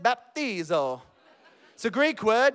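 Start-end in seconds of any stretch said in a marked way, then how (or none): 0.0-1.0 lower, drawn-out Italian-accented "baptizo". Shown as a demonstration. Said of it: "Say it in an Italian accent".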